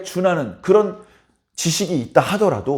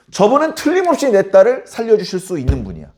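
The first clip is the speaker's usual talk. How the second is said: usual speaking voice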